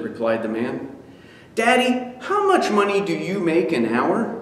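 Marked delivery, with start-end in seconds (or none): 1.5-4.4 put-on high child's voice; little boy. Son greeting his tired father, high child voice. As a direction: lift into a small boy asking.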